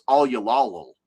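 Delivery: all you lol run together in a twang; Southern slur. Shown as a demonstration. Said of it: "which is".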